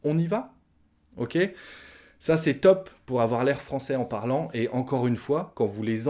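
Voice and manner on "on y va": "On y va?" high, rising on "va"; rising question tune. Shown as a demonstration. Teacher correcting to the spoken question, rising. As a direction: make "On y va?" a question by pitch alone. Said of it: "Non, on dit".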